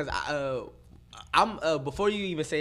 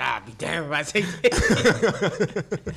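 slow laughing quote; 4 dB louder, much slower than usual. Retold or imitated. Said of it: "She said".

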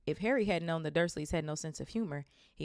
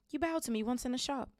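put-on boy's voice; 8 semitones higher than usual. Harry speaks.

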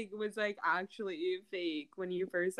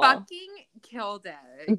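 louder, slower, emphatic praise; 7 dB louder than usual. Real, not acted. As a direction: cheer the success. Praising the spelling.

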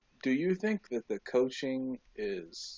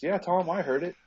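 higher and louder, eager buddy; mock Gronk; 8 semitones higher, 7 dB louder than usual. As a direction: play the loyal teammate, pumped up. Retelling Gronk answering Brady.

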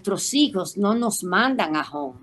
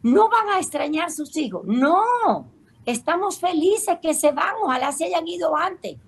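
cheerful sisters; 9 semitones higher than usual. Retold or imitated. Acted exchange with the sisters.

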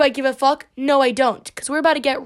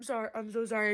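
much softer, sheepish sorry; hushed apology; 3 semitones lower, 13 dB quieter, faster than usual. Real, not acted. Host apologizing softly after shouting. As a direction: drop way down and apologize. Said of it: "if you're listening with the headphones".

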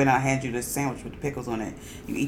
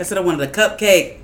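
exasperated shout; 5 semitones higher, 12 dB louder than usual. Real, not acted. Echoing friend's complaint, exasperated.

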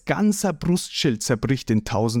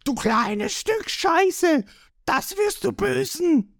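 high, strained pirate yell; drawn-out high threat; angry screaming; slower than usual. A narrator's reading. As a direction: shriek it as a charging mob. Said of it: "und schreien".

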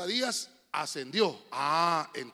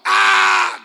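loud, high cry of Christ on the cross; Christ's cry; 12 semitones higher, 19 dB louder than usual. Retold or imitated. Preacher acting Christ's loud cry on the cross. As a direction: cry out "ah" with full force. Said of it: "gritó".

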